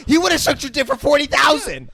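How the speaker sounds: high, loud indignant rant; heated comic outburst. Real, not acted. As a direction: shout it high in mock outrage. Heated comic outburst about salary.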